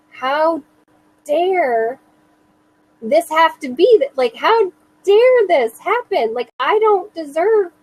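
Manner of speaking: indignant disbelief, voice climbing. Retold or imitated. Outrage at having to prepare.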